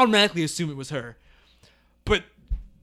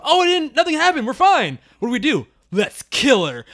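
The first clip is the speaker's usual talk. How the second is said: higher mock relieved family; mock family relief; 6 semitones higher, 5 dB louder than usual. Retelling the cult family's relief, mock cheerful voice. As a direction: play the relieved cult family, lightly hammed. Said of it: "they had that whole beat of".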